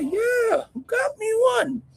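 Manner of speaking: high, cry-like put-on voice for his younger self; mock high squeak. Young inmate squealing back at Stacks about a tool. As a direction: squeal it like a startled kid.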